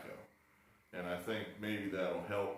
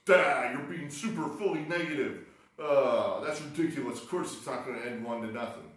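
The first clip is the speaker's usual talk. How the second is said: louder, brisk scolding of himself; scolding sensible voice; 4 semitones higher, 6 dB louder than usual. Retold or imitated. His sensible mind scolding his negativity, louder and brisk. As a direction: snap yourself out of pessimism. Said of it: "your sensible part of your mind goes".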